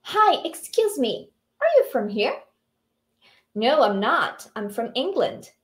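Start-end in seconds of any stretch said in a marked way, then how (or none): none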